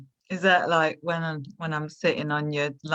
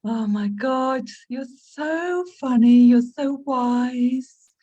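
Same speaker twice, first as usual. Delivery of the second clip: gushing flattery; 6 semitones higher, 3 dB louder, slower than usual. Acted.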